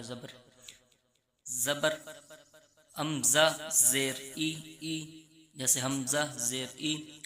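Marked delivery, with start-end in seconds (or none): 2.9-7.2 ای chanted slowly with the voice dropping down; lowered zer sound. Shown as a demonstration. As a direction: let the voice fall on ای, slow and sung. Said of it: "آواز کو نیچے". (ای کو آواز نیچے گرا کر آہستہ پڑھیں)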